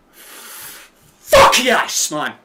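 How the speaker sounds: loud, high shout of triumph; celebratory yell. Real, not acted. Streamer celebrating a late winner, triumphant yell. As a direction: yell it out like your team just won.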